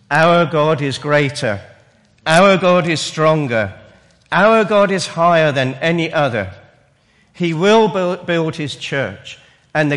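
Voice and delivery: rising proclamation, each line stronger; preaching at full force. Real, not acted.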